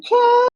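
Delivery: very high-pitched imitation of a singer's yelp; imitated rapper ad-lib. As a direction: mimic the artist's signature high squeal. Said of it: "he does this thing where he's like".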